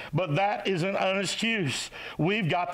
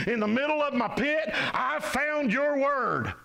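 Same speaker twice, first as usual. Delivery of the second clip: impassioned preaching, pitch lifted high; 5 semitones higher than usual. Real, not acted. Preacher putting listeners down in Jeremiah's pit, impassioned preaching. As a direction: preach it insistently, building to the quoted verse.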